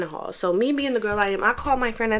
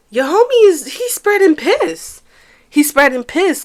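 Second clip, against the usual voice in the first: high, louder, slow spreading piss; outraged at the lie; high indignant rant; indignant outburst; 8 semitones higher, 9 dB louder than usual. Real, not acted. Angry at lying friend.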